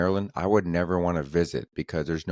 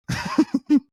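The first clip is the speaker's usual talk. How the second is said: high burst of laughter; 6 dB louder than usual. Real, not acted. Laughing at own joke.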